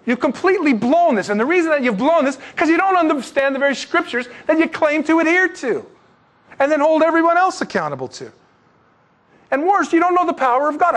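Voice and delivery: high, loud rebuke; a preacher voicing Jesus, high and forceful; Jesus rebuking. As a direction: scold them firmly for getting it wrong. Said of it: "But Jesus said".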